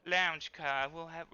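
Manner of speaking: lightly put-on effete Southern accent; Southern gentleman. Judson Conrad offering Eliza his arm, mild Southern accent. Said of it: "Judson Conrad, the Southern gentleman, says".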